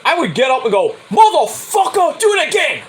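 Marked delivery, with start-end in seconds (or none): 1.1-2.8 pretend angry yell. Fan yelling at pitcher, mock furious. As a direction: bark it at the guy who hit you.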